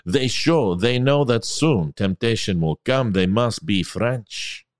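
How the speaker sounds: hammed-up French accent. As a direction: read it as a stock Frenchman, accent on every word. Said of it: "I can do Frenchman".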